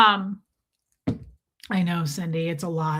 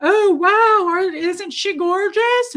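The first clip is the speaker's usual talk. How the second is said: much higher and louder, gushing, slowing on gorgeous; gushing future self; 8 semitones higher, 5 dB louder, slower than usual. Pretend. Showing off her stuffed dog to viewers, loud gushing. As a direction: gush over her like a proud owner. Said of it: "I'm gonna be like".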